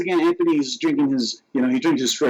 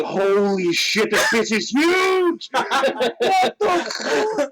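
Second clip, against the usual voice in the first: drawn-out high mock shriek; horrified shock; 10 semitones higher, much slower than usual. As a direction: stretch it out, rising into horrified disbelief. Acting out shock under the covers.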